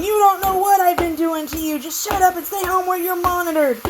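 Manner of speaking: high, mocking taunt; imitating a menacing taunt; mocking threat. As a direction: mimic the threatening messages in a high, sneering voice. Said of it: "people wearing beanies saying".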